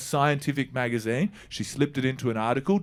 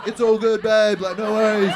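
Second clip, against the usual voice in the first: breezy reassurance; 7 semitones higher, 4 dB louder than usual. Retold or imitated. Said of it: "So I was like".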